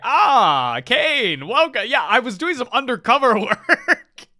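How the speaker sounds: high, swooping put-on voice, breaking into laughter; hammed-up undercover cover story; mock breezy greeting. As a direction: play a character cheerfully bluffing. Imagined character caught in disguise.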